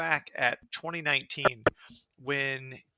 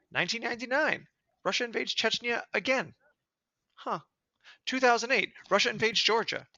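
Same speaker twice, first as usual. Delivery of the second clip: each invasion higher than the last, outraged; building outrage; 8 semitones higher than usual. Real, not acted. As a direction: list climbing higher and higher in pitch. Escalating list of invasions.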